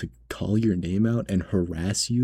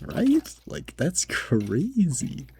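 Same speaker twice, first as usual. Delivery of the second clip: high-pitched, drawn-out disbelief; incredulous; 8 semitones higher, much slower than usual. Retold or imitated. Cashier baffled at being treated this way, incredulous. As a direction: stretch "crazy" out, high and slow.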